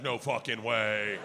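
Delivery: low, slow, deadpan no; dad refusing the escape room, low and slow; flat refusal. Retold or imitated. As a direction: dig in your heels. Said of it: "my dad's like".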